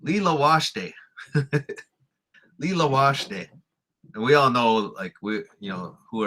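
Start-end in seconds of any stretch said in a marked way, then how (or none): 0.0-0.9 "Lila washte" high, stress thrown on LI and WASH; mock the misplaced stress, LI-la WASH-te; imitating wrong stress. Teacher imitating non-natives new to the res, awkward wrong stress. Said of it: "They always get the stress wrong". 2.6-3.5 "Lila washte" high at the front, WASH over te; imitating wrong stress. Teacher imitating non-natives learning the word, misplaced stress. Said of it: "They always get the stress wrong".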